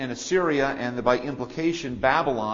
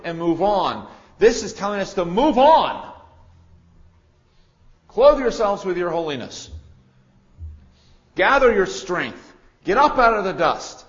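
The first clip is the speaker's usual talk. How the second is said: loud, high exhortation, slowing at the end; preaching at full force; 5 semitones higher, 10 dB louder than usual. Acted. Preacher urging the church to get up and move on, loud. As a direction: rouse the congregation to rise up.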